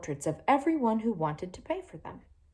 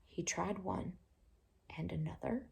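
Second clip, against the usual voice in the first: much softer, almost whispered; hushed; 12 dB quieter than usual. A narrator's reading. Reader whispers the egg trying to the child. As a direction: keep it secret and soft. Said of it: "hushed storytelling continues".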